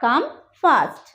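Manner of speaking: ordinary delivery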